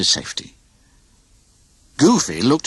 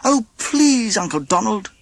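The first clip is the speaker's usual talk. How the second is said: begging nephews; 12 semitones higher than usual. A narrator's reading. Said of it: "his nephews begged".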